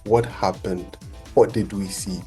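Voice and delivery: ordinary delivery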